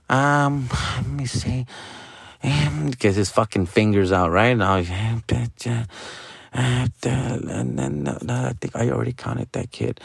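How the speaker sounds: a hint of a small kid counting softly; mumbling kid counting. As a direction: mumble through counting like a child. Son counting on fingers.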